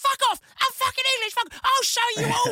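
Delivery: put-on high, whiny child protesting; a bullied boy yelling back in a squeaky voice; furious kid. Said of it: "He's like".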